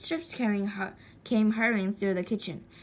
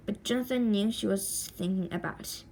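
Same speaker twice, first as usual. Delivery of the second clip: usual speaking voice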